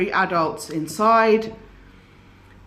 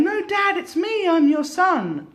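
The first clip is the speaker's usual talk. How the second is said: put-on high pleading child's cry, much higher; insistent child; 9 semitones higher than usual. Fake child begging dad through the door, high pleading cry. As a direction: plead like a small boy shut outside.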